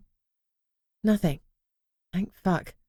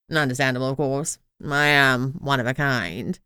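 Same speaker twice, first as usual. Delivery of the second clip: pompous crown; 6 semitones lower than usual. A narrator's reading. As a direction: savor every word like a vain aristocrat. The talking crown speaks.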